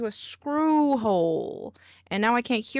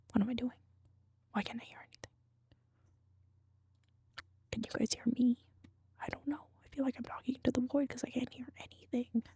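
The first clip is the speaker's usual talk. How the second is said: whispering; 8 dB quieter, faster than usual. Real, not acted. Muttered confusion to himself.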